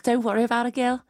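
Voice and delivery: put-on Scouse accent, friendly and chatty; lightly voiced Liverpool mum. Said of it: "that was a very good Liverpool accent".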